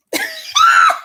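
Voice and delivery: a sudden, high, shrieking laugh; real laughter bursting out loud; cracking up with a high shriek. Real, not acted. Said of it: "Y'all don't know why I'm laughing".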